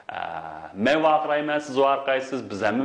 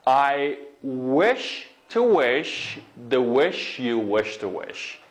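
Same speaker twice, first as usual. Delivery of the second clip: very slow, word-by-word twister; stretched-out teaching pace; slow demonstration; much slower than usual. Shown as a demonstration. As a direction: draw out each word to model it. Teacher models new tongue twister slowly.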